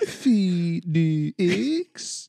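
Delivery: spelled out slowly, F E D X; slow accented spelling; mock Russian accent. Shown as a demonstration. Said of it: "what do we call it".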